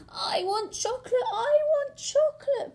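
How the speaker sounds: high, loud building tantrum cry; bawling tantrum. Acted. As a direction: scream it like a furious little girl. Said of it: "bawled the girl".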